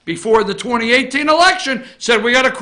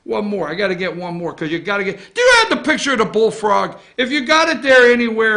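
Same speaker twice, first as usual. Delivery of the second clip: normal talking voice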